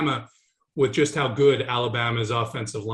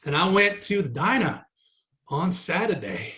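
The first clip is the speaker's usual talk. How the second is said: mock Southern accent; 2 semitones higher than usual. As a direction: play up your true Southern self, lightly. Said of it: "I've been speaking like this Midwesterner, Northerner".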